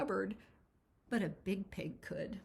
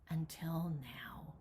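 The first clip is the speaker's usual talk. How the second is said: slow, hushed whisper; 4 semitones lower, 4 dB quieter, slower than usual. A narrator's reading. Narrator telling of lonely Granny, whispered slowly. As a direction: let it fall to a sad whisper. Granny realizing she misses Jigsaw.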